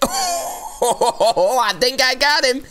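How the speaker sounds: slow, high, laughing through the words; high drawn-out laughing glee. Real, not acted. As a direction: giggle it out in disbelief. Laughing delight at hit.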